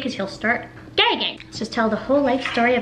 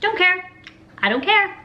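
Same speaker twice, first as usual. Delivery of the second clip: dismissive brush-off; 9 semitones higher than usual. Pretend. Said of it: "I'd be like".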